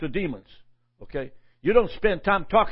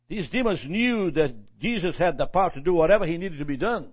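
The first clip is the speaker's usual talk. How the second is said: usual speaking voice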